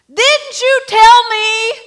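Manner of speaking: loud, high, drawn-out accusing shout; furious slow shout. Acted. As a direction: hurl the question at God in anguish. Woman storming at God.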